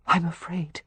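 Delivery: breathy frightened whisper. A narrator's reading. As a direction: whisper it, afraid. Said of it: "She heard herself whisper".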